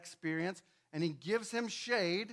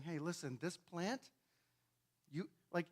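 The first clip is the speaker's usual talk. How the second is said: much softer, hushed and patient; gentle God; 2 semitones lower, 9 dB quieter than usual. Acted. God gently reasoning with Jonah, quiet.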